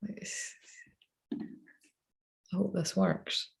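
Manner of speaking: barely voiced, slow and hushed; hopeful whisper. Real, not acted. Streamer hushes to herself, hoping the paste works, whispered. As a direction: whisper it like you're afraid to jinx it. Nervous try during live stream.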